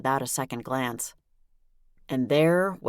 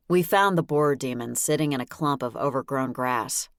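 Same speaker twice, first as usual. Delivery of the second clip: plain reading voice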